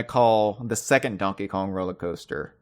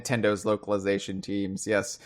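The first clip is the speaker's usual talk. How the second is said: ordinary talk, nothing marked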